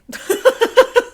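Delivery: a sudden high unvoiced laugh; high burst of laughter. Real, not acted. Co-host laughing at her own joke, loud high laugh. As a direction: break into a loud giggle.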